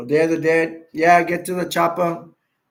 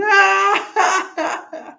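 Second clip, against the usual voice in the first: high squealing laugh; 5 dB louder than usual. Real, not acted. Laughing about big gun.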